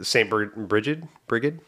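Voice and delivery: Brigid with a rising questioning end; tentative, querying Brigid; unsure pronunciation. Real, not acted. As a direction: try out the name Brigid as a question. Unsure how the name is pronounced.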